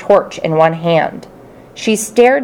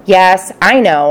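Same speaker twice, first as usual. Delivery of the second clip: plain reading voice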